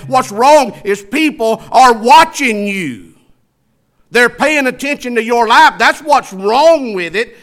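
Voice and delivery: high, loud pulpit rebuke; fiery preaching. Real, not acted. Preacher answering excuses for bad speech, fiery preaching. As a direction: fire off the questions, then drive home the answer.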